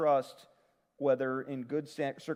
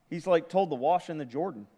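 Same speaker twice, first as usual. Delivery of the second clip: ordinary talk, nothing marked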